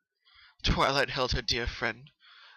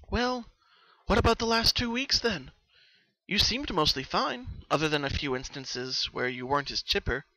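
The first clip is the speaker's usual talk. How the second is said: put-on higher, louder studious young mare; Twilight, studious; 4 semitones higher, 5 dB louder than usual. Twilight questioning Pinky about her dreams, studious. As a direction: ask it like a curious scholar.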